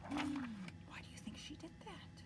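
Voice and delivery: hushed questioning whisper. Acted. Reader whispering a question to the children. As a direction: pull the kids in close.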